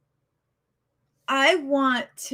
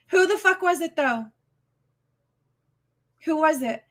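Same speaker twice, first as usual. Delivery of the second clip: higher, louder angry demand; 6 semitones higher, 4 dB louder than usual. Real, not acted. Streamer hammering her viewers for the culprit, angry. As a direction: hammer the question home. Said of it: "I'm so upset at this moment".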